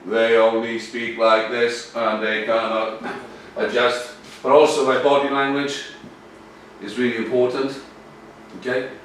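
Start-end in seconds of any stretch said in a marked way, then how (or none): 0.1-4.1 slow, low, level drone; mimicking a monotone speaker. Shown as a demonstration. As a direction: drone every word on one pitch, slowly. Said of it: "suffered terribly from monotone".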